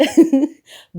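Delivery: high, loud burst of laughter. Real, not acted. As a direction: laugh it off loudly. Laughing after a tangent.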